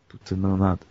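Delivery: usual speaking voice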